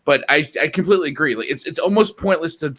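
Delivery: ordinary delivery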